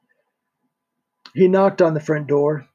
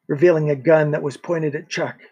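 ordinary delivery

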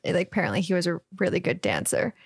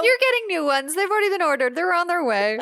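high-pitched put-on insistence; mock insistent; 9 semitones higher, faster than usual. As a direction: imitate fussy insistence, high-voiced. Acted reply about the new curtains.

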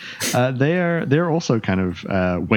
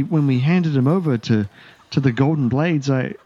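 slightly higher, stammering captive; nervous elf captive; 8 semitones higher than usual. Acted. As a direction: babble out a guilty confession.

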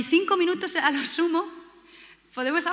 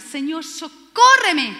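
pleading cry; 3 semitones higher, 8 dB louder, much slower than usual. Acted. Woman kneeling, begging Jesus.

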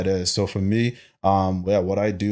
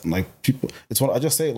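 ordinary talk, nothing marked

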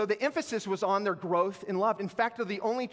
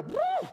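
high mock whoop. Price shooting up.

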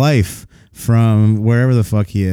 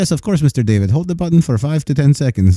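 put-on higher-pitched obliging Indian-accented agent; 5 semitones higher than usual. Agent tells Mister David to hold the button, obliging Indian accent. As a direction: eagerly reassure in an Indian accent. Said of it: "He's like".

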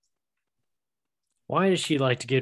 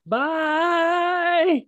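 much higher, sung-out goodbye; high squeaky bye; 5 dB louder than usual. Pretend. Sign-off at podcast end.

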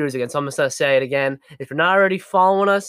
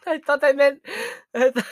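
laughing; 8 semitones higher than usual. Real, not acted. Laughing at own mistake.

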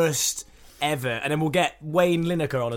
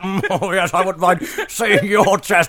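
a hammed-up, louder David Dickinson impression, sung at the start; lightly put-on cheeky antiques dealer, louder than usual; 4 semitones higher, 8 dB louder than usual. Podcast host doing his worst impression of the antiques presenter, hammed up. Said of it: "that is the worst impression ever".

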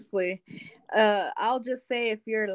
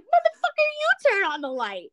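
mock outrage; 12 semitones higher than usual. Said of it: "I was like".

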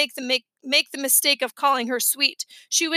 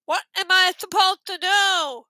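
higher, protesting through a pinched nose; stuffed-nose voice; 5 semitones higher, 3 dB louder than usual. A narrator's reading. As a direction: protest as if badly congested. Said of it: "I had to hold my nose".

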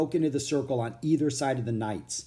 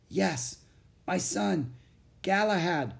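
weary knight; 7 semitones higher than usual. A narrator's reading. Weary knight names his son.

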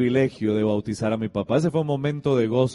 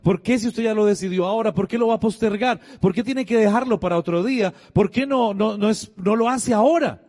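higher-pitched, insistent string of questions; persuading; 7 semitones higher, 3 dB louder than usual. Retold or imitated. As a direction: press him urgently to change his mind. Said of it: "empezó a hablar y a persuadirlo".

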